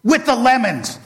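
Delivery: building from flat threat to a loud, high, hammed-up rage; villain vows revenge, building to a loud mock-furious shout; furious rant. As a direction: let the fury climb into a comic tantrum. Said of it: "Oh, he was furious".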